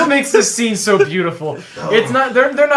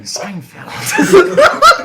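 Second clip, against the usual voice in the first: slow, loud, laughing clown voice; chewing clown voice; 13 semitones higher, 8 dB louder than usual. Acted. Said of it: "chewing clown voice".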